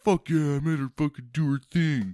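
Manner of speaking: a hammed-up victory shout; triumphant whoop. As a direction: crow over a win like a proud jock. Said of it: "And I was like".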